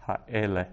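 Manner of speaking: Ha'ele with the middle E stressed; stress on second-last syllable. Shown as a demonstration. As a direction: lean on E in Ha'ele, then fall away. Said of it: "accent is on the second last syllable".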